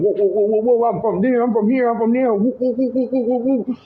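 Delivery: high, loud mock holding-cell hollering; imitating inmates yelling. Teen boy mimics older inmates in the holding cell, high loud hollering. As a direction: whoop it up like rowdy inmates in the cell. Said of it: "they say they yelling".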